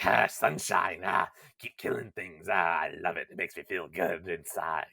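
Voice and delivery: a hint of a sneering, gloating voice; Tyron imitation. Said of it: "I was doing a Tyron imitation".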